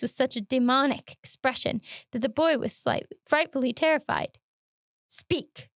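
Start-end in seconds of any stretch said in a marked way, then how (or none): 5.1-5.7 menacing cry. Acted. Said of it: "cried Todd".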